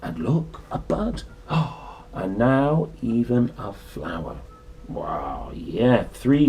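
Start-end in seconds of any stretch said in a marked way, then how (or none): none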